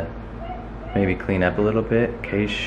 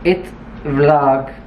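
alien robot voice; 3 semitones higher than usual. Pretend. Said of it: "She is an alien robot".

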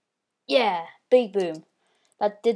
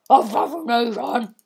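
laughing announcer call; announcer shout; 3 semitones higher, 7 dB louder than usual. Pretend. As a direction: echo the game announcer. Repeating announcer after laughing.

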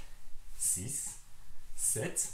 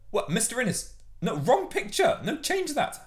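mock exasperation; 7 semitones higher, 3 dB louder than usual. Scolds staff over wrong picture.

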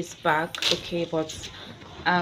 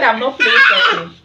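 retold complaint; 5 semitones higher, 9 dB louder, faster than usual. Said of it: "I said".

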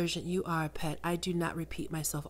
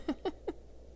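soft amused laugh; 10 semitones higher, 8 dB quieter than usual. Acted. Succubus amused by listener.

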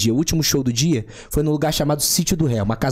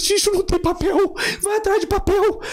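mock desperate plea. Desperate call for toilet paper.

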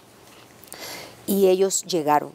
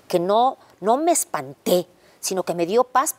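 usual speaking voice

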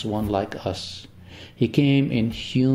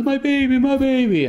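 high, loud anguished pleading; much higher desperate cry; frantic mother's cry; 13 semitones higher, 4 dB louder than usual. Retold or imitated. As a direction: wail it like a terrified mother. Mother cries over her daughter.

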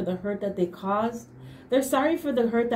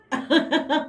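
higher, playful laugh; 5 semitones higher, 3 dB louder than usual. Real, not acted. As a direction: laugh after a flirty compliment. Playful compliment to viewer.

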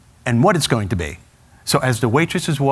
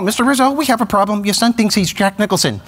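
teacher voice; 8 semitones higher than usual. Retold or imitated. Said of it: "Teacher gave me a call".

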